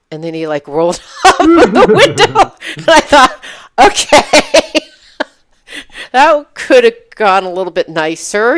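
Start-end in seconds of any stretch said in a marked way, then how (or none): 0.7-2.5 laughing through the words, high and loud; can't hold back the laugh; high laughing burst. Real, not acted. Laughing while retelling rude exit. 2.8-8.6 laughing. Real, not acted. Laughs at the rude exchange.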